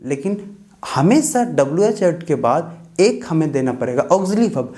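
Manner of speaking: ordinary talk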